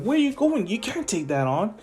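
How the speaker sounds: mock-alarmed girl's objection; acted alarm. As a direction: start high and worried for the character. Acted Uraraka's alarmed protest.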